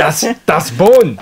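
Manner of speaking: exaggerated German accent, slow, loud and high; German accent dog. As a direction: bark it like a crazed German dog. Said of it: "screaming about a bone".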